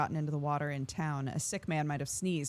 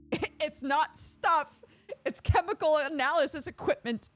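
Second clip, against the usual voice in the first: laughing; 10 semitones higher, 4 dB louder than usual. Acted. Laughing through Lara's line.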